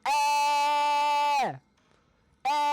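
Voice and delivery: long, high sustained note. Real, not acted. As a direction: hold a high, steady wail at the puzzle. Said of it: "it's a higher tone".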